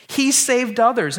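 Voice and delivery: He saved others thrown out high and loud in sarcasm; mock taunt. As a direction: sneer He saved others like a jeering crowd. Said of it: "making fun of Jesus".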